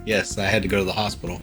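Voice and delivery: usual speaking voice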